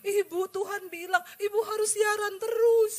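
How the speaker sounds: high, weepy imitation of a caller; tearful caller. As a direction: imitate someone gushing through tears. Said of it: "ada yang telepon nangis-nangis".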